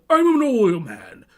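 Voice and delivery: a hammed-up oilman, slow and booming; oil man film quote. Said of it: "There will be blood".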